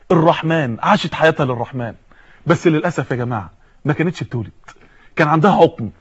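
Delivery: low, hushed sorrow; soft, low and regretful; somber regret. Real, not acted. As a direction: drop the voice gravely, with pauses. Sorrowful turn to Sarah's barrenness.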